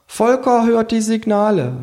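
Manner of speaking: hohe Figurenstimme, verkündend; Känguru. Acted. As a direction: den Titel hoch und feierlich präsentieren. (hohe Känguru-Verkündung)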